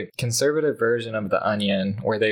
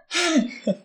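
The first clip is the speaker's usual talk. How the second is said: shaky sigh. Real, not acted. Laughing fit after joke.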